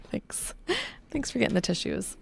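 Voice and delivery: small teary laugh into thanks; grateful, still recovering from tears; emotional. Real, not acted. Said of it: "Sorry, I'm just emotional today".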